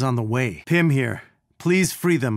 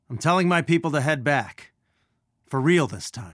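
ordinary delivery

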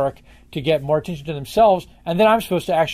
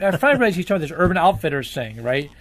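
ordinary talk

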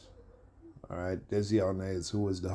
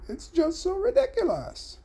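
very high mock Cuban accent; hammed-up catchphrase in a put-on Cuban accent, pitched way up. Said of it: "so-called Cuban accent".

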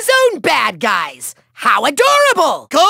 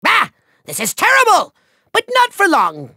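ordinary talk

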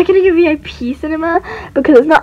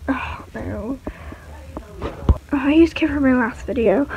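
whispering; 4 semitones lower, 9 dB quieter than usual. Acted. Owner whispering while choosing toys.

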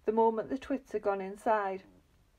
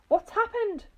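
high, loud shriek of shock; screamed; 10 semitones higher, 5 dB louder than usual. A narrator's reading. Missus Twit finding her room upturned, screaming. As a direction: cry out in alarm, pitch shooting up. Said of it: "screamed Missus Twit".

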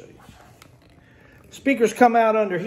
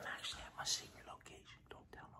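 hushed, hurried confidential aside; secretive whisper; 29 dB quieter, faster than usual. Pretend. Aside about a secret location.

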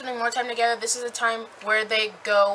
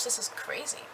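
whisper; 11 dB quieter than usual. Retold or imitated. Reacting to Alice Twilighty sister.

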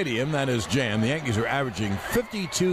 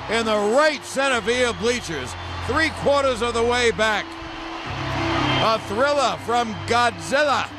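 rising play-by-play to a shout; home run call; 8 semitones higher, 3 dB louder than usual. Acted. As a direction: build the call to a roaring finish.

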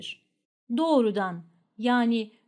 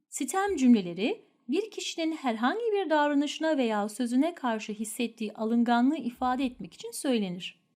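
ordinary talk, nothing marked

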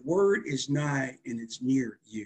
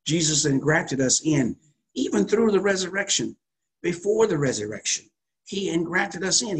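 fervent preaching; 4 semitones higher, 5 dB louder than usual. Acted. Preacher building fervour to congregation.

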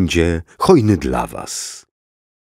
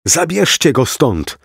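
mild shout; 8 semitones higher than usual. Acted. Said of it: "krzyknął w stronę tłumu".

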